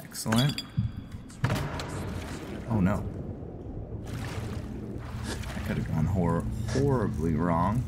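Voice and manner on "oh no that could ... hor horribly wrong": ordinary delivery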